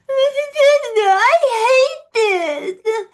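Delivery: very high, loud mock sob; mock crying. Mocking her sister crying in the river with a fake sob. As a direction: wail it like a bratty crybaby.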